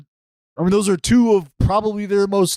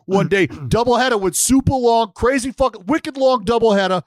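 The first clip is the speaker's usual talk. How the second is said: Boston accent impression; 5 semitones higher than usual. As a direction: ham up a proud Boston Red Sox fan with a heavy Boston accent. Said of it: "a better Boston accent impression".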